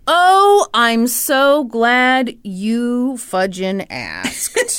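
slow, laughing sarcastic drawl. Real, not acted. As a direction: drag it out with mock glee. Sarcastic dread about mammogram.

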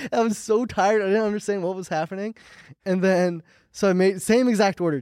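normal talking voice

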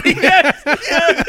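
high laughing yes. Real, not acted. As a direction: laugh through the yeses. Laughing agreement.

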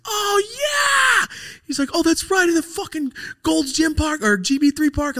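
very high, loud yell of recognition; retold friend's hollered delight; excited shout. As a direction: shout as the memory hits you. Acting out friend excitedly remembering.

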